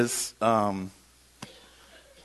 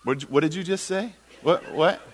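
higher, louder stammered question; baffled; 5 semitones higher, 4 dB louder than usual. Retold or imitated. Landlord to speaker after hearing about monkeys, baffled. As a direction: act out a confused double-take.

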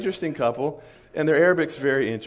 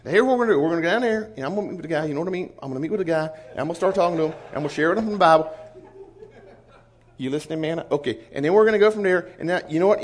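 rapid-fire Southern patter, pitched up; Wes's fast Southern; 4 semitones higher, much faster than usual. Retold or imitated. Missionary explaining the strategy to Mana, fast Southern chatter. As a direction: rattle it off in a Southern accent, words running together. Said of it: "Wes talks really fast and really Southern".

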